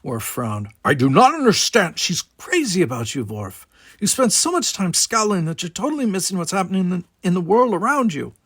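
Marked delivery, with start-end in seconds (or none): 0.8-2.0 gruff Klingon, higher and louder, set apart from the narration; a proud Klingon brushing the talk aside; Worf's voice. A narrator's reading. Worf puzzled. 2.0-8.3 lightly voiced brother, higher, teasing; a lightly voiced younger brother nudging him; Simon's voice. A narrator's reading. Simon presses his brother.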